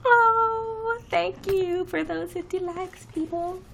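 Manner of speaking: high squeaky gushing thank-you; high delighted sing-song thanks; cutesy thanks. Real, not acted. Thanking viewers for likes.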